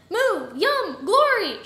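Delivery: high, loud, separate bursts; swooping, full-volume cries; shrieked exclamations. A narrator's reading. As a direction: shriek out each word. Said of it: "shrieked Mister Tiddlywinks".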